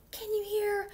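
high, tiny mouse voice; a small high stage whisper. A narrator's reading. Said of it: "and whispered".

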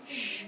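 drawn-out shushing noise; a hush aimed at the herd. Acted. Thief quieting the stolen cows, hushing.

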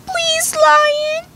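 very high, tiny begging voice; pleading mouse. A narrator's reading. Little mouse begging the lion for mercy, high pleading. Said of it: "pleaded the mouse".

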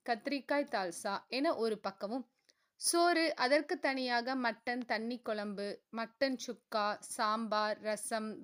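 plain reading voice

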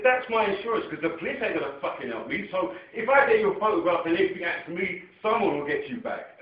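high, loud heated retort; retold shout. Telling the son off in the street, heated and loud. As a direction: fire it back at him, heated.

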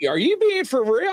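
high, imitated incredulous question; freaked-out disbelief. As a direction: act out someone losing it in disbelief. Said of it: "he started losing his stuff".

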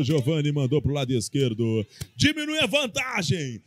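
ordinary delivery

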